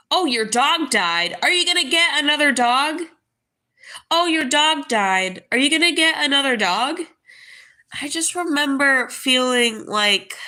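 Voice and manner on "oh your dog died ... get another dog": louder, fast mocking parrot of nosy people. Mocking the people who asked about a new dog, louder and fast. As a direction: rattle off their question like they do. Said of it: "people were like".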